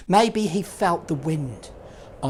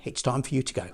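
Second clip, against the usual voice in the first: lower, softer kind send-off; gentle and soft; 3 semitones lower, 7 dB quieter, much faster than usual. Acted. Host gently tells Dudley it's time to go, soft. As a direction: say it softly, like a kind goodbye.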